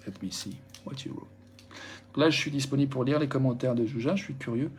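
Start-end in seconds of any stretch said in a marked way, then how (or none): 0.1-1.3 1 whispered aside. Real, not acted. Quiet aside before reading comments.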